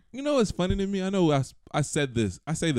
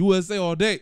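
put-on New York accent; 7 semitones higher, 5 dB louder than usual. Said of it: "a terrible New York accent".

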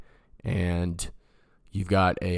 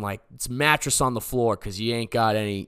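ordinary delivery

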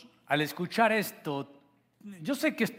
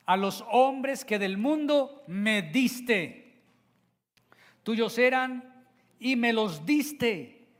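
slow, louder reading that presses on diste; emphatic slow reading; 7 semitones higher, 4 dB louder, slower than usual. Shown as a demonstration. Preacher reading John seventeen, emphasizing the repeated word. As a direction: read it slowly and weigh each word. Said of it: "quiero leer estos versículos enfatizando una palabra".

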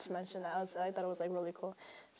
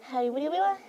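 high, bright mascot voice; squeaky cartoon mascot greeting; Domino's mascot voice; 6 semitones higher, 5 dB louder than usual. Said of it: "You sound like the, um, the mascot".

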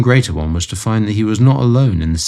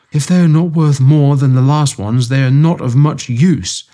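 malevolent scowl; 7 semitones higher, 5 dB louder than usual. A narrator's reading. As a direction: grumble it out with spite. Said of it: "with a malevolent scowl".

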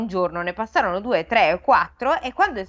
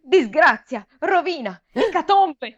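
higher, rising panic; alarmed squire; 8 semitones higher, slower than usual. A narrator's reading. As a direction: announce doom in a panic. Squire announces disaster. (scudiero in preda al panico)

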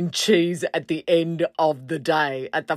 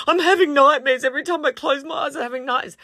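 desperate plea; 9 semitones higher, faster than usual. Retold or imitated. Jay begs the girls for help.

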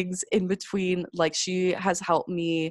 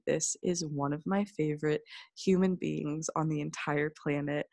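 ordinary delivery

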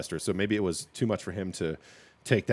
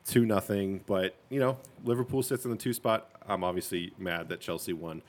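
ordinary talk, nothing marked

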